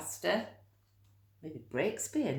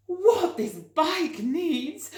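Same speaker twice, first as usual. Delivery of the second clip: very high, slow and loud; worn-out storybook lady; breathless Mrs Armitage; 13 semitones higher, 8 dB louder, much slower than usual. Acted. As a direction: stretch it out, puffed from pedalling. Mrs Armitage, exhausted, voiced.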